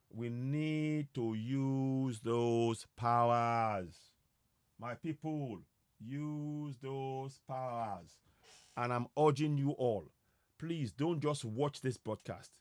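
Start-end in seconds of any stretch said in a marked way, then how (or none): 0.1-4.1 very slow, every word weighed; slow emphatic plea. Real, not acted. Rallying speech climax. 6.0-8.2 very slow, every word weighed; slow emphatic plea. Real, not acted. Rallying speech climax.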